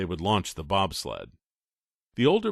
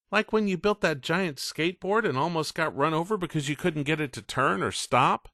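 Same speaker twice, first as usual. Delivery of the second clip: put-on higher, teasing older boy; smirking tease; 8 semitones higher than usual. Ray teasing Coker about the skateboard, smirking. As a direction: needle him with a smirk. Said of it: "Ray smirked and said".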